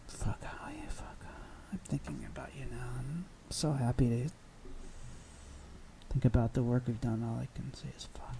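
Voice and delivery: whispered creepy confession to Howie. Pretend. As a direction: breathe it like a stalker. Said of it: "That's really creepy".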